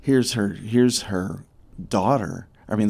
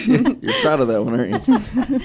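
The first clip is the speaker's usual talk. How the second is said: teasing laugh; 13 semitones higher, 7 dB louder than usual. Real, not acted. Teasing colleague after moving story.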